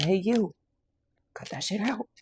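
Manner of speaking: high, loud bossy scold; tough-love auntie scolding, high and loud; sassy scolding voice. Acted. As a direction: scold them like a sassy aunt. Quoting blunt spirit, laughing after.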